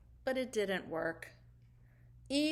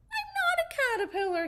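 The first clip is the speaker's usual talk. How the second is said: much higher, fast, near tears; upset protest; 3 dB louder, faster than usual. Acted. Caterpillar protesting to the laughing spider, near tears. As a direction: blurt it out close to crying. Hugo insists, upset, to Ollie.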